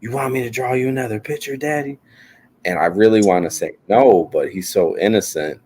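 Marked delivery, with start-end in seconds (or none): 0.0-2.0 put-on high, fast, soft little kid; a hint of an eager child; imitating his son. Son offering dad another picture, eager child voice. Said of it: "he's like".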